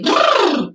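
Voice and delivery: high, buzzing brrr like shivering with cold; lip trill brrr. Shown as a demonstration. Teacher demonstrates a cold brrr lip trill for the class. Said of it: "like a sort of cold sensation".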